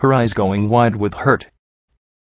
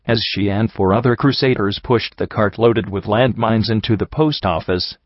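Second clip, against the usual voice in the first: plain reading voice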